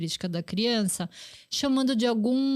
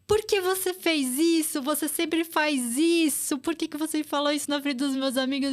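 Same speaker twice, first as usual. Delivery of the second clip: upset child, pitched higher, mock yelling at mom; a child's indignant whine, higher; retold angry outburst; 5 semitones higher than usual. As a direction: replay a kid's protest, higher and hurt. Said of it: "começou a gritar com a mãe".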